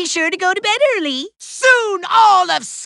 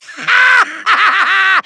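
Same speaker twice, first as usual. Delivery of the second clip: gloating cackle; 3 semitones higher than usual. Acted. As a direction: burst into hammed-up evil laughter. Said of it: "That's me gloating".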